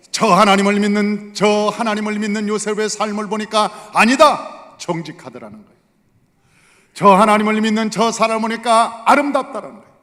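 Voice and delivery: loud, high preaching climax; forceful preaching. Real, not acted. Pastor urging the church about Joseph, full preaching force. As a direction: drive the point home to the congregation.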